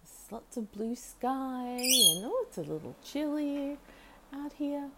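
high light chatter to the parrot; chatting to the bird. Retold or imitated. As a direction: chirp the weather report up high. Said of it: "I say".

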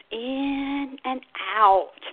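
"in and out" with both key words stretched; exaggerated contrast. Shown as a demonstration. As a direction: drag out "in", then "out". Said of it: "really exaggerate that difference".

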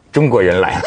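the Japanese owner sadly laments; mournful sigh. Retold or imitated. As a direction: sigh it out in defeat. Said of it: "就是说悲哀地讲".

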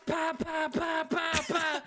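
gunfire noises. Retold or imitated. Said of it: "she's just like".

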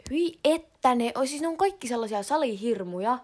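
disgusted. Real, not acted. (inhoten ja kovaa)

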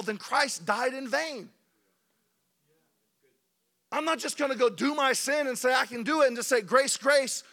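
loud, high, impassioned preaching; preaching at full force. Acted. Pastor warning the church against cheap grace, preaching full force. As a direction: preach it hard, rising to the congregation.

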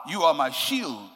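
ordinary talk

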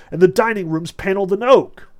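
cried out. A narrator's reading. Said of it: "Deniskin cried out".

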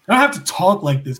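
ordinary talk

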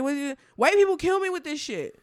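high, loud exasperation; exasperated outburst. Real, not acted. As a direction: throw up your hands in disbelief.

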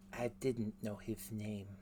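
quiet, shy admission; soft sheepish boy voice. Acted. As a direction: say it small and meek.

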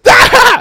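loud high "Ah!" yell; mock shout. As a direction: belt out a sudden high yelp. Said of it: "he just goes".

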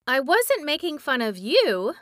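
put-on boy protesting to the angry witch. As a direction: defend yourself earnestly. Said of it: "protested Tip".

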